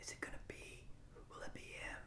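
very soft, suspenseful stage whisper; hushed crowd whisper. Acted. Game master voices the crowd falling hush, awed whisper. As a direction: whisper it in dread-filled anticipation. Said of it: "so finally everyone falls hush".

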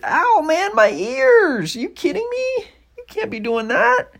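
very high, loud pained yelp; squealing, loud, outraged whine; dragon in pain. Acted. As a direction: complain loudly, ears ringing. Dragon's ears hurt by scream.

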